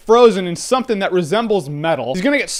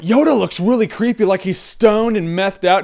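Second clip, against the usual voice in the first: ordinary delivery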